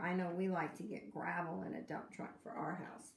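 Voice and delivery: ordinary delivery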